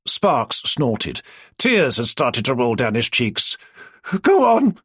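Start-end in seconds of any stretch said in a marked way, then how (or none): 4.2-4.8 high and louder, breathless from laughing; breathless go on through laughter; gasping for air. Acted. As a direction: squeeze it out between gasps. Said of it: "almost gasping for air".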